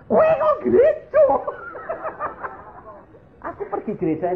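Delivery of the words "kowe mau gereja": very high, loud incredulous squeal; shocked disbelief. Retold or imitated. Husband learns his wife goes to church, shocked disbelief. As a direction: shriek the question in comic shock.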